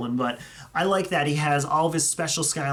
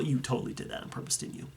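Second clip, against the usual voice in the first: quiet teasing; 3 semitones lower, 10 dB quieter, faster than usual. Real, not acted. Teasing the fan about a figure.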